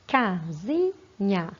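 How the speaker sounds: Casinha drawn out slowly as ca-si-nha; slow classroom syllable split. Shown as a demonstration. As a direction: count the syllables of Casinha aloud. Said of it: "a gente vai separar em sílabas".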